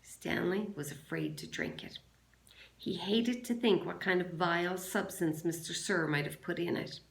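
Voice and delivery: plain reading voice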